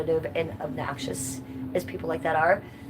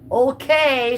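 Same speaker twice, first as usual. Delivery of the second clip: sarcastic okay; 6 semitones higher, 7 dB louder than usual. Retold or imitated. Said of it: "I think she's being sarcastic there".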